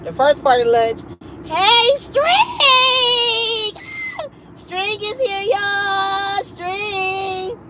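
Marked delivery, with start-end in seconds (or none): 1.4-7.6 high, ringing call of a name; excited cheer. Retold or imitated. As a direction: hype her up as she walks in. Coworkers cheering her arrival.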